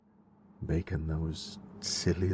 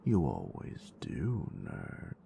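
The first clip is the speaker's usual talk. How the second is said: soft, close, teasing whisper to a lover; intimate whisper; 2 semitones lower, 7 dB quieter than usual. Acted. Teasing her nerd with lights, close intimate whisper. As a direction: whisper it close, possessive and playful.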